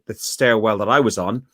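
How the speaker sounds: ordinary delivery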